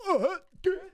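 overcome, voice breaking; choked-up cry on dude. Retold or imitated. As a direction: voice cracking high with emotion. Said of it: "I just felt my heart like".

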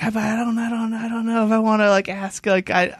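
high, whiny retold hemming and hawing; reluctant stammering. Retold reluctance to ask store staff.

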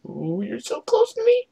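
high squealing giggle; excited squeal. Real, not acted. Squeal as seeker nears.

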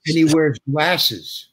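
slow, spelling out each detail; mock-earnest slow recital; slow deliberate reading. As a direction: read the description out very slowly, point by point. Casting woman reads character description.

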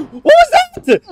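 very high startled what was that; real shock; shocked squeal. Real, not acted. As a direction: squeak it out in disbelief. Shocked by the game.

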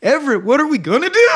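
high, quick mock-panicked yelp; half-laughing imitation of an alarmed cry. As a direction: imitate someone losing footing mid-line, pitch swooping up. Quotes character slipping mid-line.

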